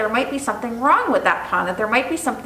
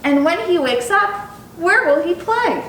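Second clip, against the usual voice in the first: mother's voice; 6 semitones higher than usual. Acted. Mother questioning the boy.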